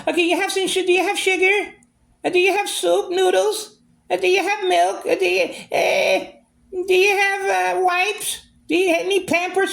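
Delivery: high mock whiny voice asking for things; mocking her requests. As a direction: squeak out her endless requests in a high mimicking voice.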